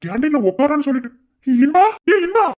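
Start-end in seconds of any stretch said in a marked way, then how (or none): 1.6-2.5 shouting a friend's name in alarm; calling out. Acted. Friend shouting to injured Inba, alarmed call. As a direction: yell his name high and loud.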